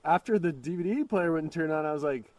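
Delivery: ordinary delivery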